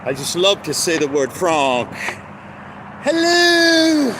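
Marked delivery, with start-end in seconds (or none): 3.0-4.1 calling out hello. Real, not acted. High greeting to a passerby from the car. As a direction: call a cheerful hello out. Greeting someone while driving.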